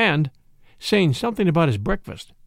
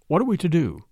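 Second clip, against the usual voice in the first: ordinary delivery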